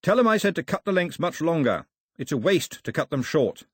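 mild shouted instruction; shouting after someone. A narrator's reading. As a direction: call an order after a departing messenger. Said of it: "Mao shouted after him".

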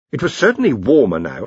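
plain reading voice

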